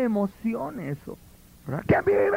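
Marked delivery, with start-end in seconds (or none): 1.9-2.3 high shouted call to the crowd; hammed-up crowd rouser; overdone church yell; mock hype shout. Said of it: "un gritadero".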